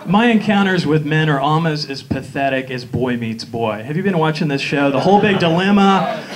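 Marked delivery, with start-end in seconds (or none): none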